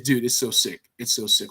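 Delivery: ordinary talk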